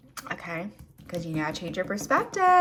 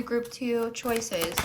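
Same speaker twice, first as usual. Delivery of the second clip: usual speaking voice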